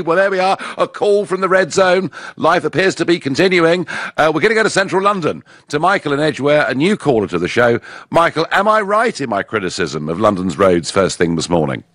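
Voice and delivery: ordinary delivery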